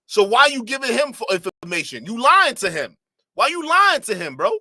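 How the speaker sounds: incredulous callout; heated disbelief. Real, not acted. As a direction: press him on the lie, voice climbing high. Calling out friend's lie.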